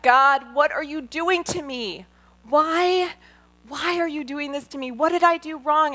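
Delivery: high, loud cry of why me; reliving the shouting at God; anguished yell at God. Retold or imitated. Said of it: "I started yelling".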